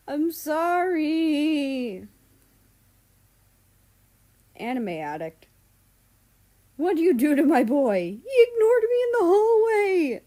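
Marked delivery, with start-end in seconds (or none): 0.1-2.1 whiny apology. Acted. Mal apologising in chat. 6.8-10.2 loud, high crying complaint; wailing. Acted. America wails to the group chat, loud and crying.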